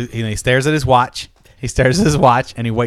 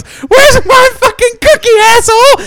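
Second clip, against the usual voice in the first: furious tapeworm; 13 dB louder than usual. Acted. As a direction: scream it as an enraged little creature. Angry tapeworm delivers the punchline.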